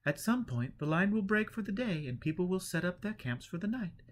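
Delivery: high, kindly woman's voice. A narrator's reading. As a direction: explain it gently and high. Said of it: "The woman in front said kindly".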